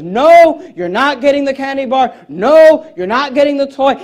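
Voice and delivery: acted stern mom, each "no" jumps high and loud; firm refusal. Preacher acts out mom refusing the candy bar, firm. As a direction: put your foot down as a mother.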